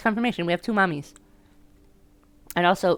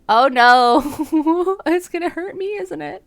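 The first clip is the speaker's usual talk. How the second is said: high, loud cry dissolving into laughter; laughing dismay; 13 semitones higher, 9 dB louder, slower than usual. Real, not acted. Player bracing for the game to hurt, laughing dismay. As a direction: laugh through your dread, pitched way up.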